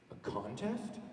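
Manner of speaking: mock-scared small voice, rising at the end; timid, hushed question from a frightened hot dog. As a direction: ask it very softly, pitch climbing as if frightened. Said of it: "my impression of a scared hot dog".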